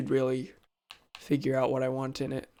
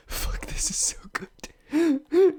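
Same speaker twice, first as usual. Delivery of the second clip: slow excited whisper; 11 semitones higher, much slower than usual. Real, not acted. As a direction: breathe it out secretly. Delighted whisper to herself.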